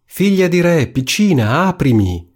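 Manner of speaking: high storybook call, easing down. A narrator's reading. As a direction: frog calling to be let in. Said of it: "si udì bussare per la seconda volta e gridare". (richiamo acuto del ranocchio alla porta)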